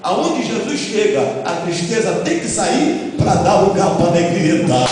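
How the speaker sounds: loud, high chanted preaching climax; full-force preaching. Acted. Pastor rousing the congregation, loud and chanted. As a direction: lift the church up, full voice.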